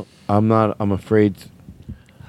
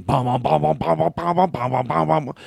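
trombone imitation.

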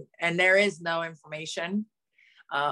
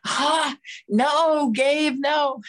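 higher, louder, fighting back emotion; choked-up protest; 8 semitones higher, 6 dB louder, much slower than usual. Real, not acted. Coach announcing she is stepping away, choked up and pleading. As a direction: plead with him not to set her off.

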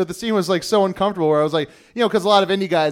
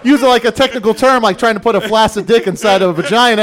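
normal talking voice

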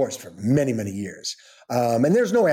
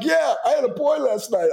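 much higher goofy voice; 13 semitones higher than usual. Retold or imitated. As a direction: blurt it out like a dazed goofball. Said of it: "I'm, like, goofy".

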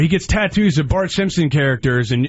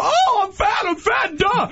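high, whiny mockery of his comeback; mocking whiny voice; 11 semitones higher, much slower than usual. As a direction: squeak it out like a dismissive whiner. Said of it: "every time somebody rips on you being fat, you go".

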